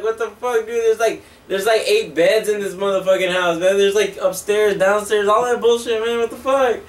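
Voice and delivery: high-pitched exasperated rant; mock indignation. Van owner protesting to his friend about the beds, acted indignation. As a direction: climb high as the retold protest builds.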